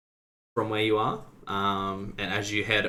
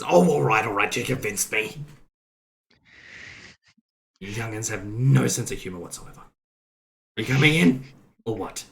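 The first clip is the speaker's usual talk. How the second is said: put-on grumpy old man. Old guy NPC relenting.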